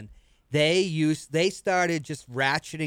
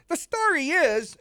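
slow, much higher New York drawl; New York accent; 13 semitones higher than usual. Retold or imitated. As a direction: pitchman launching his spiel, New York accent. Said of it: "heavy New York accent".

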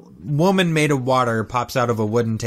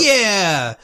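high, drawn-out eager shout; hammed-up rallying cry; mock team cheer; 7 semitones higher, 4 dB louder than usual. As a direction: imitate the whole gang piling in. Mimics whole team eagerly agreeing.

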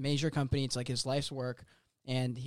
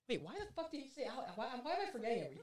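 confused mumble; 7 semitones higher, 15 dB quieter, much faster than usual. Acted. Mock villain forgetting line.

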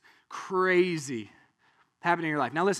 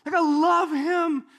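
high, loud hammed-up fan; adoring excitement; 11 semitones higher, 5 dB louder, much slower than usual. John the Baptist cheering his cousin, adoringly excited. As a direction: gush with playful, drawn-out delight. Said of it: "He's like".

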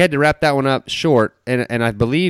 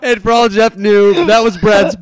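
laughing; 9 semitones higher, 3 dB louder, slower than usual. Real, not acted. Laughing at story.

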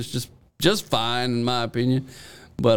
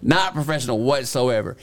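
ordinary delivery

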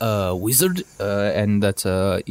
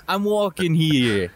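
slow, raised-pitch Boston caricature; Boston accent; 5 semitones higher, slower than usual. Shown as a demonstration. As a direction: drag it out in a put-on Boston accent. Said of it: "Let's hear your Boston accent".

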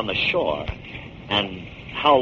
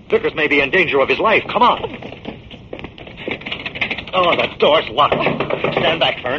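urgent call; 4 semitones higher than usual. Acted. Detective rushing assistant back to the shop, urgent. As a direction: hurry her along. Urgent rush to save Vickers.

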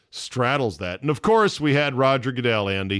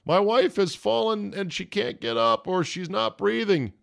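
high, frantic call for help; panicked cry for help; 9 semitones higher than usual. Retold or imitated. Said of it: "no one running out of the room screaming".

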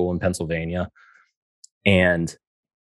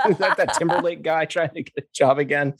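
laughing; 10 semitones higher than usual. Retold or imitated. Mocking interviewer, laughing.

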